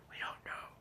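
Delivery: whispered teasing aside. Real, not acted. Host teasing next episode in a whisper. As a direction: whisper it like a secret.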